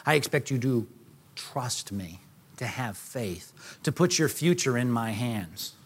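steady the frightened disciples quietly; calm reassurance. Pretend. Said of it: "he says".

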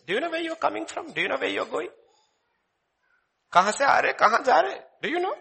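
high, playful quizzing; mock questioning. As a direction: tease the question, laughing a bit. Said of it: "famous questions".

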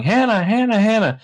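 high, loud mock yelling of a name; retold yelling. Recapping the rush to rescue Hannah, mock frantic yelling. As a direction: call out frantically, hammed up. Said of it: "Lots of yelling".

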